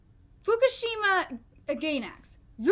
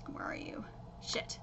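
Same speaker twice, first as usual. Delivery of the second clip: whispered under breath; muttering to self; 15 dB quieter than usual. Real, not acted. Reviewer hunting for the manga page, whispering to himself. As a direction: whisper while searching the page.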